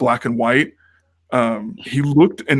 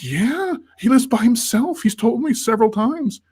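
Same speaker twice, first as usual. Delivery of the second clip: put-on high, eager kid convincing mom; eager insistence; 9 semitones higher than usual. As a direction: act out insisting, pitched way up. Child insisting to doubtful mom.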